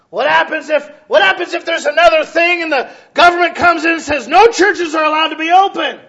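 loud, high preaching; preaching at full force. Acted. Pastor preaching hard to his church, loud and high. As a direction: raise the voice over the congregation.

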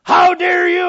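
high, explosive mock outrage; over-the-top indignant scream; screaming your head off. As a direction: shriek it like a hammed-up hothead. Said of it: "scream your head off".

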